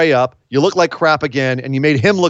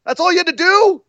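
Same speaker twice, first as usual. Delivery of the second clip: incredulous outburst; 11 semitones higher than usual. Real, not acted. Incredulous rant.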